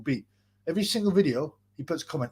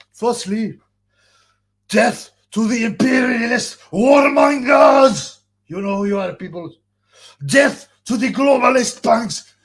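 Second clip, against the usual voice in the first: booming higher shouted dictator speech; Muammar Gaddafi voice; 4 semitones higher, 7 dB louder than usual. Retold or imitated. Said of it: "not that good of an impressionist when it comes to Muammar Gaddafi".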